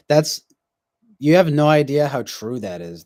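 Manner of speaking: ordinary delivery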